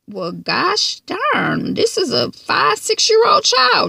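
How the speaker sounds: slow, rising to very high pitch in disbelief; exasperated disbelief. Real, not acted. Vlogger reacting in disbelief to Ash scolding a child. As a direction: draw it out, outraged by the scene. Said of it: "And I felt kind of attacked".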